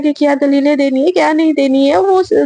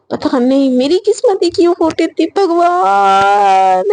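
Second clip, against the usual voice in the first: slow, stretched-out mock despair; inward lament; 2 semitones higher, slower than usual. Shreya silently cursing her fate while feeding Sejal, drawn-out lament. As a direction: draw it out in hammed-up exasperation. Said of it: "मतलब दिल ही दिल में बोलती है".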